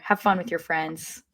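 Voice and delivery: normal talking voice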